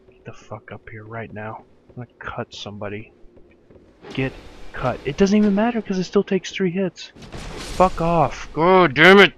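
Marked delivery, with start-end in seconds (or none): 0.1-3.1 low, much softer threat under the breath; muttered threat. Real, not acted. Player quietly threatening the enemy to come closer. 7.2-9.3 a real furious yell at the game; angry outburst. Real, not acted. Gamer yelling at the game after dying.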